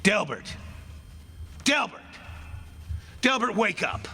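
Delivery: high, urgent calls of his name; calling to wake him. Acted. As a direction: rouse the sleeper with a high call. Waking the sleeping murderer.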